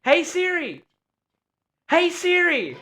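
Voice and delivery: very high-pitched summons; high, insistent wake-up call; frustrated calling. Real, not acted. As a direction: call again in a high, strained-patience voice. Frustrated repeated calls to assistant.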